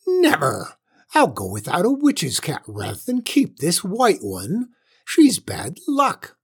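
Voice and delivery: high, furious witch screech; shrieking witch. A narrator's reading. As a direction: refuse in a swooping angry shriek. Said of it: "shrieked the witch".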